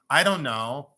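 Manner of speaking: ordinary talk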